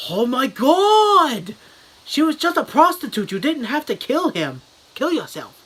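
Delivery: high, loud hammed-up Japanese-accented outburst, winding down at the end; mock Japanese accent. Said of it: "not making fun of how they talk".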